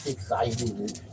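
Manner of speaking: normal talking voice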